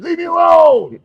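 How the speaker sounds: high, loud screamed plea; screaming. Retold or imitated. Guest reenacting his own screaming to the host, loud shout. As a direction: scream it like you're pushing someone away. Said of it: "and screaming".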